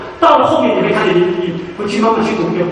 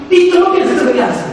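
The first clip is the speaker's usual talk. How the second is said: shocked friends; 8 semitones higher, 4 dB louder, faster than usual. Retold or imitated. Friends shocked seeing Job.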